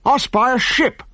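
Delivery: high lookout cry; sudden call. A narrator's reading. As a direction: call out the ship at once. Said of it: "called Asterix suddenly".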